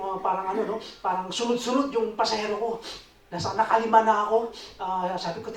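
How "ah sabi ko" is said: ordinary talk, nothing marked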